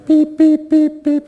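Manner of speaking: high, loud mock beeping; shrill appliance beep imitation; microwave beeps.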